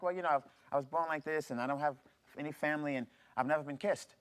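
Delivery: usual speaking voice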